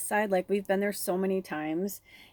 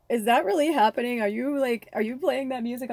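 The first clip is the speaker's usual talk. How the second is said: excited disbelief; 5 semitones higher, 5 dB louder than usual. Retold or imitated. Retold shock at song playing.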